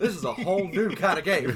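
laughing awe. Retold or imitated. Recalling his first God of War boss, laughing in amazement. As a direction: marvel at it through laughter. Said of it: "I was like".

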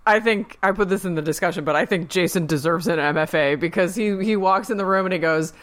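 normal talking voice

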